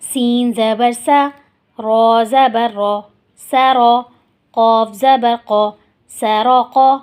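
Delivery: slow, deliberate letter-by-letter recitation; slow spelling drill. Shown as a demonstration. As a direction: drill each syllable slowly for the class. (آہستہ ہجے کی مشق)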